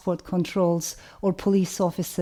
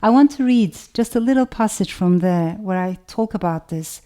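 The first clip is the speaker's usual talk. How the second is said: ordinary delivery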